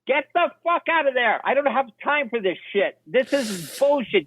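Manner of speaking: mock furious yell, pitched high; retold scream. Producer yelling at the director in the fort, mock angry. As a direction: bark at him to get out, imitating. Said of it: "And Scott would scream".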